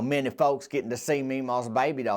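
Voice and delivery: ordinary talk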